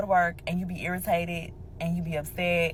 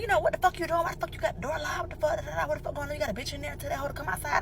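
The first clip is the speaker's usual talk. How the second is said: heated confrontation; 9 semitones higher, 5 dB quieter, faster than usual. Retold or imitated. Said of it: "So I'm like".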